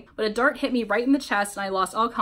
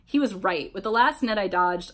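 ordinary delivery

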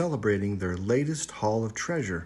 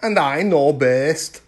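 louder, stubborn chief; dismissive chieftain; 5 semitones higher, 10 dB louder than usual. A narrator's reading. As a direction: scoff at the girls. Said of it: "replied the chieftain".

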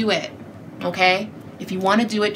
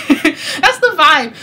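laughing; 5 semitones higher, much slower than usual. Real, not acted. Laughs while saying it.

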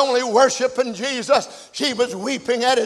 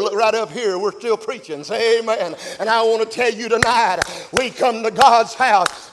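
ordinary talk

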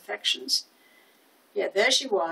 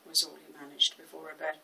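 usual speaking voice

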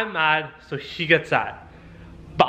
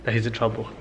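normal talking voice

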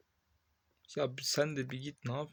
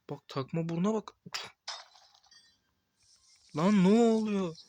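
angry shout; 7 semitones higher, 9 dB louder than usual. Real, not acted. Gamer yelling at the chaos on screen, outraged shout. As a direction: bellow it in disbelief.

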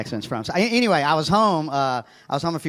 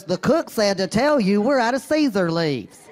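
slow, higher, straight-faced waitress; deadpan waitress; 8 semitones higher, slower than usual. Retold or imitated. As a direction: deliver it dead serious, a little slow. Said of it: "with a completely straight face goes".

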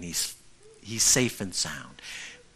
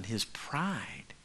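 whispered; 9 dB quieter than usual. Real, not acted. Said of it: "whispered word".

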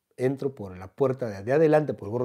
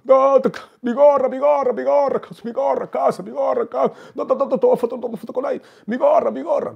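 very high, loud, frantic wail; 12 semitones higher, 10 dB louder than usual. Retold or imitated. Celebrity wailing to the teller about his stolen cap, very high and loud.

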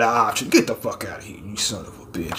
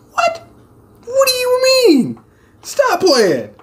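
very high, squealed mock disbelief; high-pitched comic protest; incredulous outcry. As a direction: squeal it in exaggerated confusion. Acted outburst at a cryptic answer.

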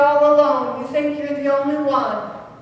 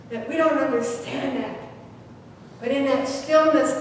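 normal talking voice

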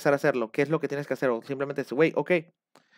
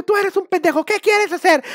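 high, loud, slow hammed-up yelling; mock coach yelling; 13 semitones higher, 5 dB louder than usual. Coach yelling at a student to break him down, mock shout. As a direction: bark insults like a cult coach.

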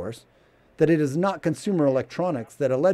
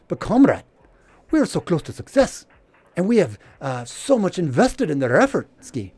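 pleading mock Russian accent, louder; 4 semitones higher, 3 dB louder than usual. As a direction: beg the comrade in a hammed-up Russian accent. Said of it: "That's my Russian accent".

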